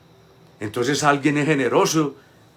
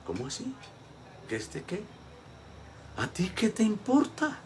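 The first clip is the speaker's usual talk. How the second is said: soft, then a sharp higher ¿A ti qué te importa?; a lightly voiced, irritated Jesus; annoyed Jesus; 10 semitones higher, 8 dB quieter than usual. Jesus rebukes Peter about John, a touch annoyed.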